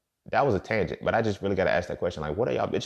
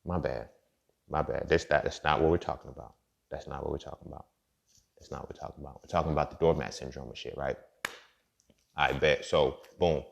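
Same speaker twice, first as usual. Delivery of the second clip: much softer and lower, reining himself in; calming back down; 8 semitones lower, 7 dB quieter than usual. Real, not acted. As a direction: pull it back to a hushed apology after the rant. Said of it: "I had to center again".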